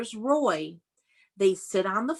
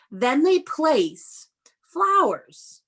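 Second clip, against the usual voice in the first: slow read-aloud; 5 semitones higher, 4 dB louder, much slower than usual. Shown as a demonstration. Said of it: "reading slowly to children".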